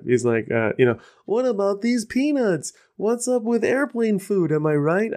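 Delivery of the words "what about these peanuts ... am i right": bad Jerry Seinfeld impression. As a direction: botch a Seinfeld impression on purpose. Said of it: "I can't do it".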